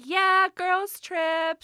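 high, loud, drawn-out cheer; hammed-up excitement. Saleswoman hyping a girls' trip, mock excited. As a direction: whoop it like a hype-girl saleswoman.

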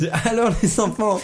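high teacher calling the class; chirpy classroom teacher addressing kids; schoolteacher voice. Pretend. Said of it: "T'as fait une voix de maîtresse".

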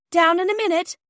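high, loud call from upstairs. Acted. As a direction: call down the stairs to the children. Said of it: "a voice sounded down the narrow".

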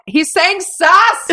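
high, loud, drawn-out disbelief; mock outrage. Viewer reacting to comments that missed it, high outraged protest.

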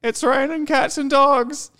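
milk the lame joke slowly; corny cartoon husband. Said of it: "they'd be like".

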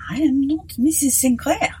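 a sudden, curt whisper; abrupt. Acted. Priest refusing to discuss Mrs Sinclair, abruptly. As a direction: cut him off short. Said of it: "he said abruptly".

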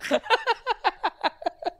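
high breathless wheeze; gasping helpless laughter; wheezing laugh. Real, not acted. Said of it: "He's just laughing".

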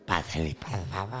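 put-on low old witch voice; old Baba Yaga voice. Baba Yaga inviting the hero inside, low old crone voice. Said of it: "La abuela voz de Baba Yaga".

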